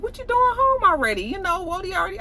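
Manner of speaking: imitated happy partner, pitched very high; happy surprise. Derek asks Keyshawn why she's home already, happily surprised. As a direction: play up being pleased she is home, voice way up. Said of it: "He's so happy to see her".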